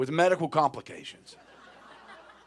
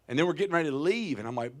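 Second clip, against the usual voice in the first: normal talking voice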